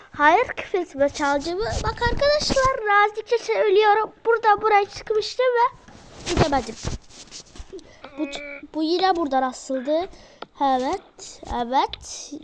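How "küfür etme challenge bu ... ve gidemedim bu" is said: normal talking voice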